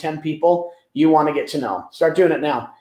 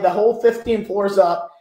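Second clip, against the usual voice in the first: normal talking voice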